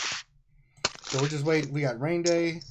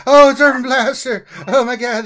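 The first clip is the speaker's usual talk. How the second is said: excited greeting; 9 semitones higher, 10 dB louder than usual. Real, not acted. Friend finally joins the call.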